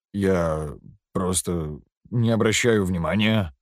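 slow, measured, each word drawn out; slow deliberate reply. A narrator's reading. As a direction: speak slowly, unhurried. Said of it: "потом медленно произносит". (медленно, размеренно)

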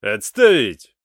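loud sharp bark; barked order. A narrator's reading. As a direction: snap the command like a commander. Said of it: "рявкнул командир". (рявкнул)

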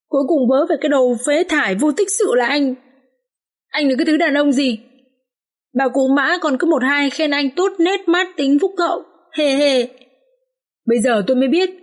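acted high, derisive complaint; scornful berating. A narrator's reading. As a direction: throw the praise back with scorn. Wife berates and mocks her husband.

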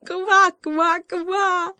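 high, loud triumphant frog croaks; triumphant croaking. Acted. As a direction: croak in victory like a tiny frog. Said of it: "торжествующе проквакал лягушонок". (торжествующее кваканье, высоко и громко)

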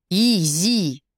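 low rumbling call of the name. Acted. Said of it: "пророкотал его голос".

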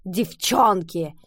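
slow, drawn-out annoyed cry; exasperated exclaim. A narrator's reading. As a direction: throw up your hands in exasperation. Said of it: "раздражённо воскликнув".

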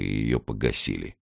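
ordinary delivery

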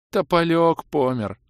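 high, slow, sorrowful elf voice; mournful sigh. A narrator's reading. Tearful elf mourns his ghost helper, sighing. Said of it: "вздохнул лысый эльф".

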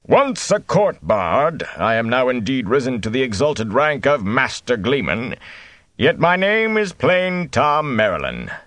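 slow, booming declamation; grand bard voice. A narrator's reading. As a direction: proclaim it to a great hall. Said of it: "his voice once more seemed to reverberate in a great hall".

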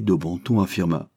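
ordinary delivery, nothing marked

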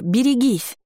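high-pitched cry of alarm; urgent but not full-volume shout; warning cry. A narrator's reading. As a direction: call out a sudden warning, pitch leaping up. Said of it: "крикнула".

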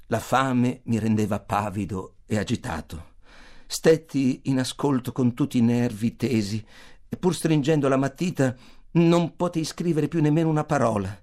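plain reading voice

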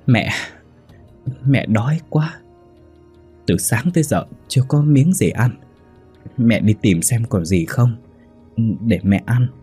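put-on timid, halting plea of an old mother; mildly acted frightened mother; cowering. A narrator's reading. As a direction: plead meekly, shrinking back. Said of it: "Bà Tâm rúm ró đáp".

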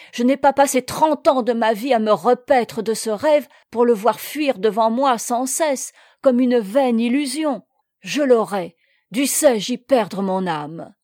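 loud, impassioned tirade; worked-up, forceful vow; animated. A narrator's reading. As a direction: pour out indomitable determination. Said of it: "reprit-il en s'animant".

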